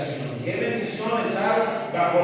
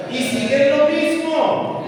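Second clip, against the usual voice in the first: louder slow repeat; 8 semitones higher, 6 dB louder, slower than usual. Real, not acted.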